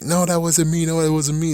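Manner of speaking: high-pitched protesting denial; mock frantic denial. Reviewer voicing a framed judge, mock frantic denial.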